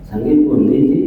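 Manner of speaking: gentle Javanese greeting, close by; Javanese polite speech. Retold or imitated. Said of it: "Kalau orang Jawa kan enggak".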